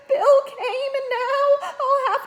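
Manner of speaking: put-on high, loud tearful girl's voice; a frightened young girl crying in relief; Ginny sobbing. A narrator's reading. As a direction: blurt it all out through sobs. Ginny keeps weeping.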